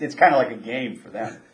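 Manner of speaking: usual speaking voice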